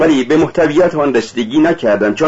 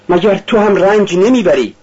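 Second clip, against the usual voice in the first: higher-pitched, mild outcry; crying out; 7 semitones higher than usual. A narrator's reading. Sonia asks if he suffers too, crying out. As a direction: exclaim it in protest, pitched up. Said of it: "سونیا فریاد زد".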